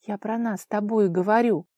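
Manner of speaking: ordinary delivery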